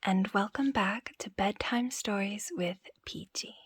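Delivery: soft whispered storytelling; bedtime story whisper. Acted.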